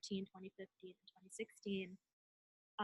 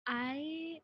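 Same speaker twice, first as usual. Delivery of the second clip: nervous laugh; 7 semitones higher, 4 dB louder than usual. Real, not acted. Nervous start of her introduction.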